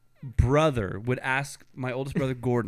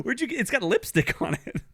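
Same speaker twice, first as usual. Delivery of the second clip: ordinary talk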